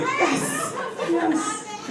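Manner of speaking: high, hushed little cheer; rejoicing. Acted. Child rejoices that rescue is coming, hushed glee. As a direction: celebrate softly in a high voice. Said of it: "I'm immediately rejoicing".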